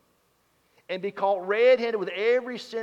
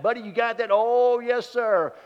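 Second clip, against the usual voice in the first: voicing Al and Buddy; 6 semitones higher, 7 dB louder than usual. Stepfather and Buddy voiced.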